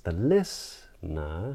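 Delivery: slow "listener", dipping then rising; circumflex inflection. Shown as a demonstration. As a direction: stretch "listener" and bend the pitch up at the end. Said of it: "and I just did it".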